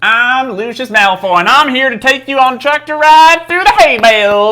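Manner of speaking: hammed-up Southern tour guide, Alabama accent; Malfoy with a mock Alabama accent. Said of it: "Now we're doing Alabama".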